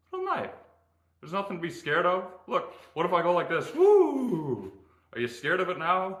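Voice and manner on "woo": high ghostly woo; spooky woo. Pretend. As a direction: wave it like a ghost. Spooking viewers with a knife.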